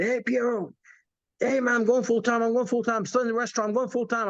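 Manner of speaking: high, eager retelling of his younger self; bursting with news to his upline; excited young self. Retold or imitated. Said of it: "very excited".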